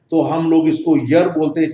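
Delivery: ordinary talk, nothing marked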